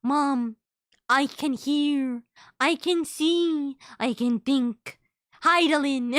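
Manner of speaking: slow, solemn, dawning words; a creature discovering thought for the first time; awakening pet voice. Retold or imitated. Pet newly able to think speaks to mom slowly. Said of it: "Puts it on Sunshu".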